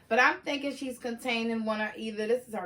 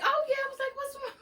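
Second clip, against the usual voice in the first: lifts her voice high to replay her reaction; squeaky high replay of her own surprise; high retelling voice; 13 semitones higher, faster than usual. Retold or imitated. Narrator recalls her surprise at the stranger, pitched high.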